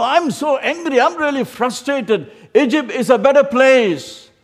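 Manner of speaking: high, loud furious ranting; angry crowd. Retold or imitated. Men in the wilderness shouting at Moses in anger. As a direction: rage at Moses like an angry mob. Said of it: "the men are really jumping and saying".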